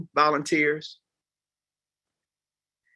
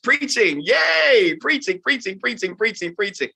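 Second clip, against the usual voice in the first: hammed-up yay fading out; mock cheer; 7 semitones higher than usual. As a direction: cheer it like a kid, then let it sag. Mock cheer about preaching.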